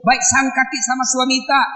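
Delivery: usual speaking voice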